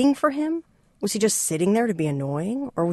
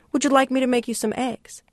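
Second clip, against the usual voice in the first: lightly voiced owner, higher pitched; Angel's voice; 7 semitones higher than usual. Angel offering hungry Richard some eggs, lightly higher voice. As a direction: offer it kindly as the shop owner.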